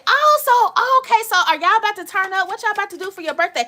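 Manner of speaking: excited. Real, not acted. Excited over viewer's birthday.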